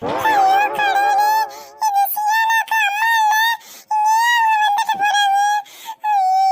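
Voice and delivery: high, tearful lament; mother frets tearfully about the ghost; wailing. Acted. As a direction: wail to God in distress.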